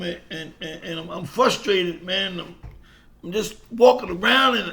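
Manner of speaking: ordinary talk, nothing marked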